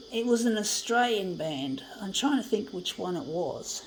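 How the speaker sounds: usual speaking voice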